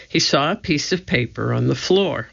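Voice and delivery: ordinary delivery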